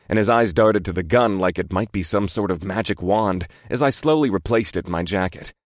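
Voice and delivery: plain reading voice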